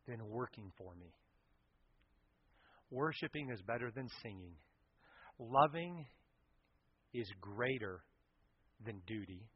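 Jesus gently correcting Martha; gentle Jesus. Acted. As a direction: answer her kindly like a patient teacher.

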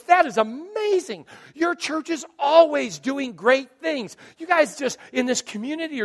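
high, loud, drawn-out gush; gushing praise. Retold or imitated. Teller praising the pastor's church, gushing and high. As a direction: gush with stretched, high-pitched words.

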